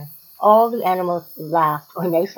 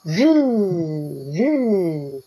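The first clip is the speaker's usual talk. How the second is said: long, drawn-out "zoo" gliding down; whining mosquito buzz. A narrator's reading. Guilty mosquito buzzing in people's ears, whining. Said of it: "she goes about whining in people's ears".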